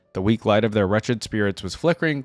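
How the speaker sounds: ordinary talk, nothing marked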